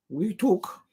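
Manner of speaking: hint of a cartoon duck voice; lightly voiced cartoon duck; Daffy Duck. YouTuber slipping into a cartoon sound, lightly voiced duck. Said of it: "sounds like".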